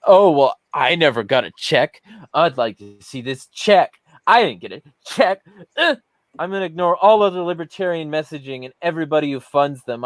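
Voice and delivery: mocking imitation, pitch jumping up on the complaints; snotty, defensive mimicry. Retelling an opponent's answer about the party's funding, snotty mock defensiveness. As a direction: imitate a condescending whiner with disgusted grunts. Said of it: "he just got real defensive, snotty, and fucking condescending".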